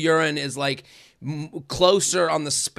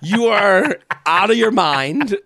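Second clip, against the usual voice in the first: louder, slow indignant scolding; exasperated rant; 2 semitones higher, 5 dB louder, slower than usual. Real, not acted. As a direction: call them crazy, slowly and loud. Ranting at the penny-pinching emailer.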